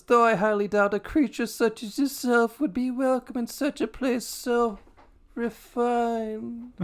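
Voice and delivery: high, haughty snooty voice. Acted. Pride sneering at Fury in a high snooty voice. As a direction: look down on her from on high. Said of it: "a fucking snooty voice".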